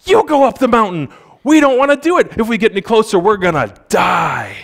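high, panicky mock plea of the frightened people; lightly hammed-up terrified Israelites begging Moses; scared crowd pleading. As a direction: hand it off to Moses like a nervous crowd. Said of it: "And they were like".

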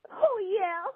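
high-pitched "oh, yeah" whoop; mustered-up catchphrase cheer. Pretend. Caller signing off to the hosts after a hard message, mustered-up high cheer. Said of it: "how you mustered up a "oh, yeah".

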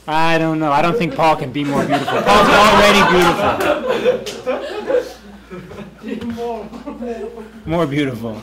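talking through laughter; joking while laughing. Real, not acted. Laughing joke about Paul.

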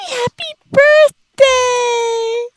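high, slow, stretched birthday cheer; sing-song cheer. Acted. Cheering a Sim's birthday, high and stretched out. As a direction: draw out the celebration high.

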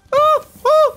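very high, loud yelp; startled cry; sudden high alarm. Real, not acted. Alarm at enemy.

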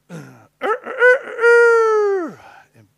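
high, loud crowing rooster imitation. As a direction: crow like a rooster.

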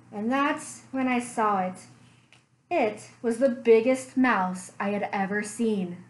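story narration. A narrator's reading. Librarian reading a bear story to children, gentle storytelling. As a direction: draw the kids into a cozy mystery tale.

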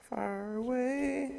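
high drawn-out echoing call; far from mic. Retold or imitated. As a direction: stretch it out like a distant echo. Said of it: "sounds like you're far away".

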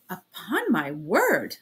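slow, drawn-out offended outburst; a man insulted, dragging out his indignation; wrathful, irritated cry; wrathful and irritable. A narrator's reading. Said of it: "cried wrathfully and irritably".

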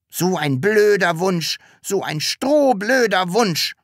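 high, cross little voice; grumbling scolding. A narrator's reading. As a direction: scold indignantly, building on the second insult. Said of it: "schimpfte das Sams". (trotziges, hohes Schimpfen)

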